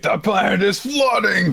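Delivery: high alarmed mock announcement; mock dramatic shout. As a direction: shout it like a disaster. Quoting dramatic plot line.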